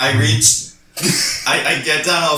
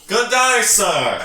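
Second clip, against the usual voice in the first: high, hammed-up hail to a guard; playful, theatrical salutation; in-character greeting; 8 semitones higher, much slower than usual. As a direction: call up a grand greeting. Player hails guard in character.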